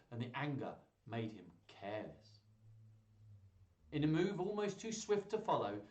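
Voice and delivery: ordinary delivery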